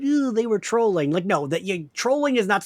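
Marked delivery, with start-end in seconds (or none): 0.0-1.1 imitating complainers. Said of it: "when they're like".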